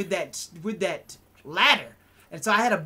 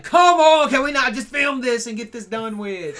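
loud, higher at the start; exasperated outburst; 3 semitones higher, 7 dB louder than usual. Retold or imitated. Host fed up with friends ruining the takes, exasperated. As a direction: burst out, fed up with the jokes. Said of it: "and I'd just be like".

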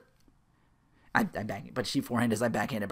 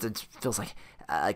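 ordinary talk

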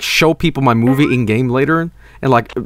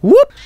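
high, loud rising whoop; vocal whoosh that shoots upward; whoosh sound effect; 6 dB louder than usual. Shown as a demonstration. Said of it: "a whoosh sound effect".

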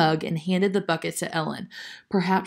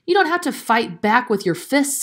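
impatient, higher-pitched retort; 6 semitones higher, 3 dB louder than usual. A narrator's reading. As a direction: correct her with some impatience. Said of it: "said Grandfather impatiently".

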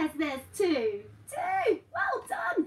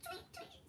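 high playful "Tweet, tweet" as a joke; mock wrong animal noise; 13 semitones higher, 17 dB quieter than usual. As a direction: pretend a mouse says "Tweet". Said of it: "not tweet".

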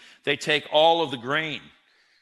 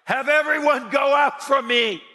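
shouted high and loud; loud cry; 12 semitones higher, 6 dB louder than usual. Acted. Joseph ordering everyone out, a loud high cry. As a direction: cry it out at full force. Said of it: "and he cried".

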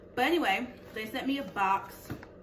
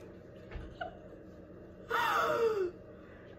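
wheezing gasp. Real, not acted.

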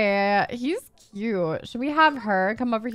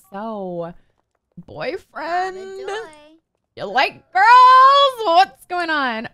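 forward, out there; 3 semitones higher, 6 dB louder, much slower than usual. Pretend. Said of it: "out there".

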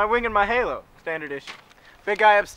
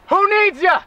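loud dismissive; 12 semitones higher, 10 dB louder, slower than usual. Acted. Angel shrugs off rejection.

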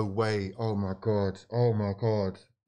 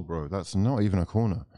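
normal talking voice

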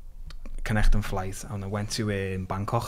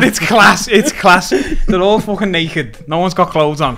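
usual speaking voice

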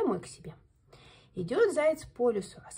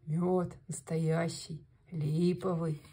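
low, slow bear voice; proud bear; 5 semitones lower, much slower than usual. Acted. Bear presents honey to the hare family, low and slow. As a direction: boom it out like a big kind bear.

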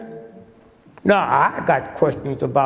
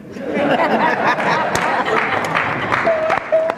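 high laugh; 11 semitones higher than usual. Real, not acted. Comic laughs at his cash punchline.

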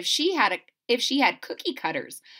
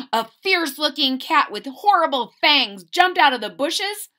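higher and louder for the fierce cat; thrilling read-aloud moment; dramatic storytelling; 6 semitones higher, 6 dB louder than usual. A narrator's reading. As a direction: build it up big for the kids. Narrating the fierce cat's arrival.

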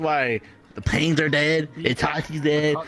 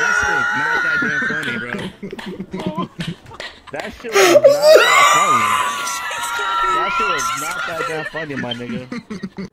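laughing; 6 dB louder than usual. Real, not acted. Protesting while cracking up.

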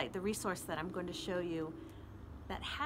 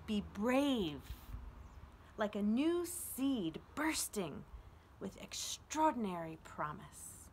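slow, uplifting poem recital; poem reading. Acted. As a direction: read it as a gift of encouragement. Reads poem Majestic aloud.

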